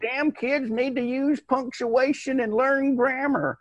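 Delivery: put-on high, slow cranky old man griping; grumpy old man. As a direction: gripe like an old man scolding kids.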